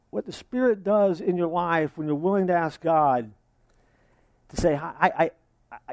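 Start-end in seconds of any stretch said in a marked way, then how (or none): none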